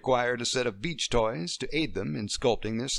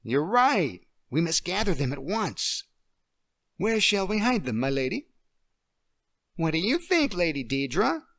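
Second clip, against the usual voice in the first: put-on higher, regal little girl queen; a little girl playing queen; child playing queen; 7 semitones higher, 4 dB louder than usual. Emily playing the queen for Deirdre.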